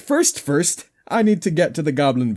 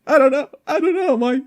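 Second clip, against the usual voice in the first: rising to a squeak; climbing higher on each I don't know; squeaky high; 11 semitones higher than usual. Pretend. Confused player repeating himself in a squeak.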